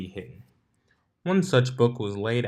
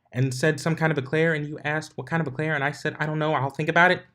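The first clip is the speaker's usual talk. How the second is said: higher, fast rapid-fire chatter; motormouth; 7 semitones higher, faster than usual. A narrator's reading. Pinkie rushing her story to Twilight like a motormouth. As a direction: rattle it off without a breath. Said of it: "Miss Motormouth".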